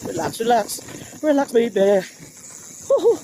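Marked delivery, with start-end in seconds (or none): 2.9-3.2 excited whoop. Real, not acted. Whooping while riding downhill.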